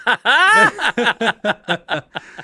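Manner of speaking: big cackling laugh; loud laugh. Real, not acted. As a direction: burst of high laughter. Reacting to Wii hot take.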